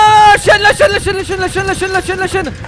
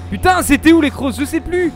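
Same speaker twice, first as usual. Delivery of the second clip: higher, louder frantic search; panicky; 10 semitones higher, 7 dB louder than usual. Real, not acted. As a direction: ask in a panic. Lost while being chased.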